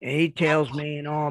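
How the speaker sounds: ordinary delivery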